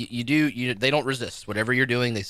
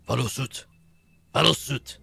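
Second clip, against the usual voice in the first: creature's command; 3 semitones higher, 4 dB louder than usual. Acted. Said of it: "it looks at you and says".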